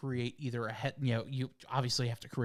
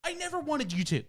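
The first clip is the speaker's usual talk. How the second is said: high-pitched mock exasperation; fed up, voice pitched way up; exasperated protest; 11 semitones higher than usual. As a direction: whine it up high, word by word. Acted exasperation at the assistant.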